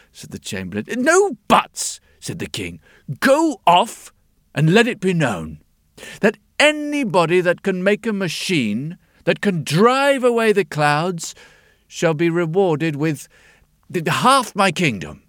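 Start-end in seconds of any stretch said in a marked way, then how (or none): 1.0-2.0 high, loud snapping king; imperious king. Acted. King cuts him off. 3.2-15.2 a touch of commanding king; royal proclamation. Acted. King gives royal order.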